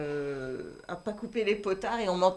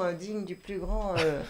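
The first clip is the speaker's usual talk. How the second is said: ordinary talk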